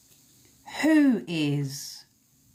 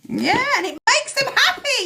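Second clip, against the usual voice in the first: mocking sneer; 5 dB louder than usual. Mocking the smug wrongdoers.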